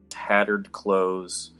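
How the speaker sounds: ordinary talk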